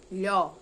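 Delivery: "Lhó" alone, loud, with open o; isolated sound. Shown as a demonstration. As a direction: sound out "Lhó" as one strong syllable. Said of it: "Outro som". ("Lhó" isolado, forte, com o aberto)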